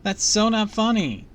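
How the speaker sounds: high, loud, drawn-out complaint; shouted protest. A narrator's reading. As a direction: shout indignantly, stretching the words. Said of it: "I heard Harmony shout".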